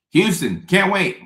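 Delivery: high, loud burst of excitement; can't-wait cheer to the Houston crowd; excited shout. Real, not acted. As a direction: yell it out, thrilled to go. Excited shoutout to Houston tour stop.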